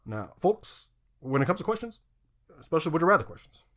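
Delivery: mock presidential address to the folks; Obama. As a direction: play a politician making an appeal. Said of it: "I'm a Barack Obama".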